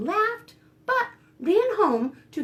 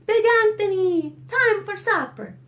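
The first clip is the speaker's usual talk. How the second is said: high, loud call to supper; 8 semitones higher, 5 dB louder than usual. Acted. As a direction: call across the yard. Stregonona calls him in.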